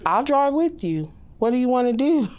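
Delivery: offering help sweetly; lightly voiced child. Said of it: "even friends give out".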